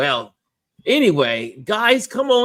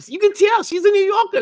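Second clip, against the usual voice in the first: higher, louder mock excitement; playful tease; 7 dB louder than usual. Real, not acted. Host teasing a guest's New York voice, higher. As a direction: hype her up jokingly.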